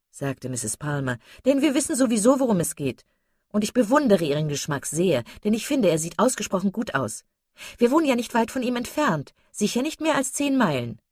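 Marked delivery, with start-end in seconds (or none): 1.5-11.0 high, lively gossip; effusive chatterbox lady. Acted. Mrs Palmer teasing Marianne about her admirer, high and gushing. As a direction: gush knowingly, pitch jumping up.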